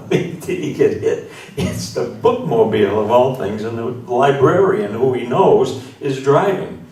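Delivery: loud, high comic storytelling; animated build-up. Retold or imitated. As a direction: build the outrage toward the punchline, stretching key words. Building to punchline, man screaming and yelling.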